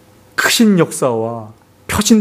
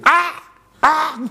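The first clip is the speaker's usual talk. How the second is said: mock yelp of pain, pitched up; imitated cry of pain; 10 semitones higher, much slower than usual. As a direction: yelp like someone jolted by pain. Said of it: "아내의 비명소리가". (아파서 지르는 짧은 비명 흉내)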